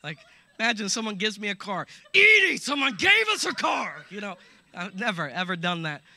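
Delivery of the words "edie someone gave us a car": mock furious shout, high and loud; husband shouting good news to his wife angrily; angry voice. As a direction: yell good news as if enraged. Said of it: "with an angry voice".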